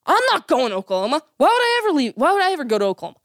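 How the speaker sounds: high-pitched, indignant mock protest; mock snapping at reporter. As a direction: act out snapping back, pitch leaping up. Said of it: "snap at the reporter, yell at the reporter".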